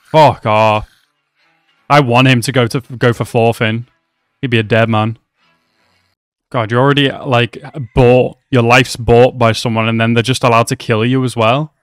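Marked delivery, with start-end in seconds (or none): none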